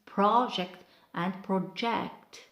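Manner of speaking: first "project" stressed early, second "project" late; noun versus verb stress. Shown as a demonstration. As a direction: slowly contrast "project and project": PRAH-ject, then pro-JECT. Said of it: "see the difference on pronunciation".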